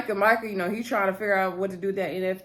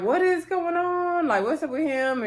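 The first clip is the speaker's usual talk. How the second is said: surprised aunt; 8 semitones higher than usual. Retold or imitated. Aunt asking her friend what's wrong with him, surprised and high. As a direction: stretch it out, baffled and nosy. Aunt reacts to nervous nephew.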